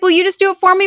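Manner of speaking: put-on high, childish plea; high, whiny little-girl request; pleading daughter. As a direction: beg like a scared kid.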